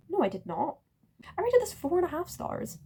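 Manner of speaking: correcting herself, voice lifted; flustered correction. Real, not acted. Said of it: "I'm already making mistakes".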